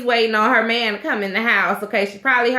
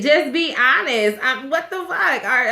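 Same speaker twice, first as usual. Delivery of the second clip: ordinary talk, nothing marked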